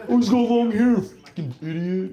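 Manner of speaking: mocking audience member. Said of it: "You're laughing at me".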